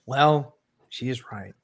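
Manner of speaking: low, soft secret admission; under the breath. Acted. Imagining the fan secretly agreeing, said under the breath. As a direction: drop it down as a private aside. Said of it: "secretly under his breath, he was like".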